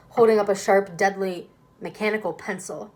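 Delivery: ordinary delivery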